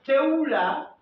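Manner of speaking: T'es où là? called out clearly to the class; dictated loudly. Shown as a demonstration. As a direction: dictate T'es où là? so all can write it. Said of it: "Écrivez cette phrase".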